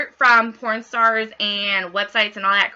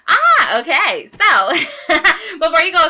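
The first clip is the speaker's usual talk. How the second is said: loud laughter breaking through the words; laughing; 6 semitones higher, 7 dB louder, slower than usual. Real, not acted. Host laughing at her own bush joke, loud laugh. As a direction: crack up at your own joke.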